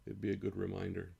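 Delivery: normal talking voice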